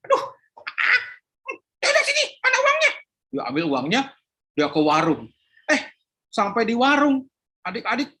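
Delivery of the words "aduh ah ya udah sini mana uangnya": exasperated mother. Acted. Mother gives in.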